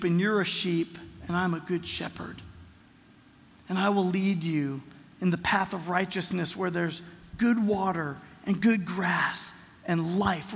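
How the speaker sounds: tender invitation. Pretend. Jesus invites him tenderly.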